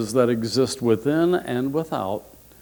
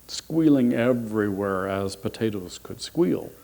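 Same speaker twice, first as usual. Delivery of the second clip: ordinary delivery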